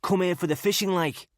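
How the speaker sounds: put-on chummy Scouse accent, all smiles; oozy Scouse. A narrator's reading. Scouse ex-para explaining his holiday to Gardner, oozy Scouse. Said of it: "in oozy scouse".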